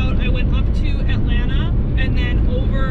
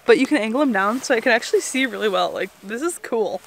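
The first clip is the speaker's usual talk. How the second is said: usual speaking voice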